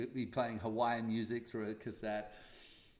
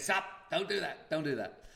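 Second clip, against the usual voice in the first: firm; 5 semitones higher, 5 dB louder than usual. Retold or imitated. Telling Pam to stop.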